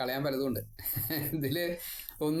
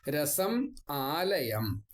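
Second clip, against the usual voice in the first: slow recitation; much slower than usual. Acted. Quoting verse words slowly.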